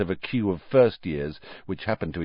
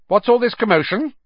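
bossy prefect; 14 semitones higher, 6 dB louder than usual. A narrator's reading. Prefect Percy arriving.

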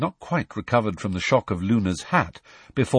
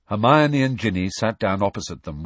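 usual speaking voice

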